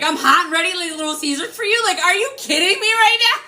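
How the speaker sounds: high-pitched, incredulous retort; appalled. Retold or imitated. Woman retelling her comeback to a presumptuous suitor, appalled. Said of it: "I was so appalled".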